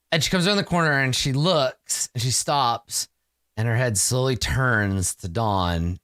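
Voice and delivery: ordinary talk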